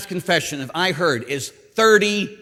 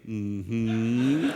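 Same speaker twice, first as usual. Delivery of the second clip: long low hum, rising at the end; knowing mm-hmm; 8 semitones lower, 6 dB quieter than usual. Retold or imitated. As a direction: imitate disapproving women with a drawn-out hum. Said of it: "all the women would look at me and go".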